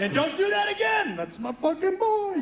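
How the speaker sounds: high, half-laughing proud father; proud dad aside. Retold or imitated. A dad secretly proud of his son's lie, half-laughing. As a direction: sneak a proud little laugh into the scolding. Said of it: "You're like".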